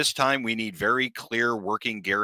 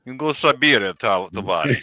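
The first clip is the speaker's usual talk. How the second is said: put-on Russian accent; 3 dB louder than usual. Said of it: "Speaking, uh, with a Rux-, Russian accent".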